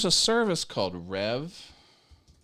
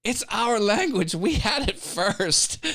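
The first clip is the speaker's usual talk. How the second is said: higher, louder, slow indignant English voice; haughty comeback in an English accent; proud mock retort; 10 semitones higher, 4 dB louder, slower than usual. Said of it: "I'm like".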